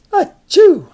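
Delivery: loud high fake achoo; mock sneeze. Shown as a demonstration. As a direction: act out a big sneeze.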